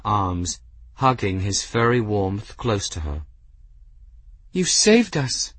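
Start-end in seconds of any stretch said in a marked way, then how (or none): 4.5-5.5 choked up. Acted. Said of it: "then she did begin to cry".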